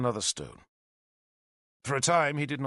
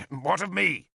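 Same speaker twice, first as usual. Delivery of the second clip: defiant; 7 semitones higher than usual. A narrator's reading. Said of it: "Killick asked defiantly".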